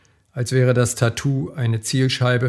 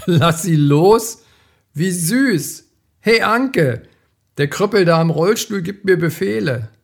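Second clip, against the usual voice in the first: mocking thug; 6 semitones higher, 3 dB louder than usual. A narrator's reading. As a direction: sneer it back like a taunt. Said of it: "dann polterte Lachen".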